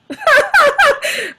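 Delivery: a burst of high real laughter. Real, not acted. To friends on the call, a high laugh.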